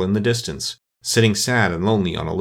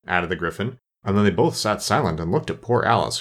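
ordinary delivery, nothing marked

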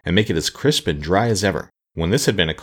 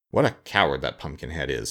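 low, softer scorn; scornful; 5 semitones lower, 5 dB quieter than usual. A narrator's reading. Sawhorse belittles Jack, with low scorn. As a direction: sneer it low and quiet. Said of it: "said the sawhorse scornfully".

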